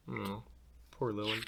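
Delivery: ordinary delivery, nothing marked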